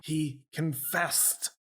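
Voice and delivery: slow, high, overdramatic reveal; theatrical. Acted. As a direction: stretch it out like a stage actor. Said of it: "I get all theatrical with my arms".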